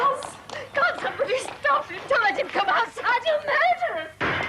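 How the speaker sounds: frightened. Acted. Neighbour fears violent drunk.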